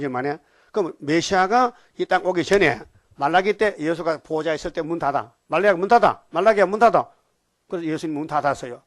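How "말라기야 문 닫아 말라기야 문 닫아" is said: loud order to shut the door; commanding call. Acted. Preacher voicing God, loud command. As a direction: boom it out like a command.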